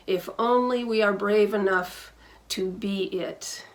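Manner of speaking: ordinary talk, nothing marked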